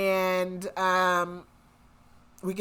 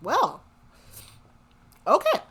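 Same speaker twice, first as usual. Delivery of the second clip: rising higher and louder with each okay; dumbfounded, climbing higher in pitch; stunned okay; 8 semitones higher, 5 dB louder than usual. Retold or imitated. Podcaster retelling her shock at the twist, stunned and rising. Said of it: "I was like".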